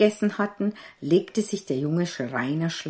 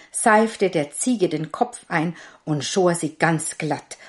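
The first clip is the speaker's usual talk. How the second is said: ordinary delivery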